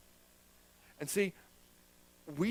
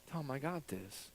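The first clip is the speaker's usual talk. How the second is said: calm reply as God; 3 semitones lower, 13 dB quieter, slower than usual. Retold or imitated. Voicing God's calm reply.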